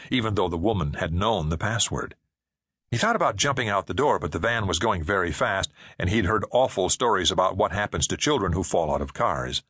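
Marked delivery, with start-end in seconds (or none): none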